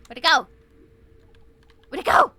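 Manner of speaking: loud startled shout; sudden loud game yell. Real, not acted. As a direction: yell it at the screen.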